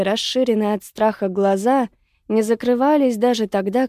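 usual speaking voice

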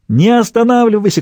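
high, urgent narrated shout; urgent yell, pitched well up. A narrator's reading. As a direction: cry out to keep them going. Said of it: "кричал Дуг".

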